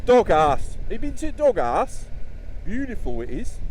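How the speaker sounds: loud, high East Midlands mock local; local Nottingham accent. Vlogger mocks the locals' East Midlands accent, loud and high. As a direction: shout it like a proud Mansfield local. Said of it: "That's how they talk around here".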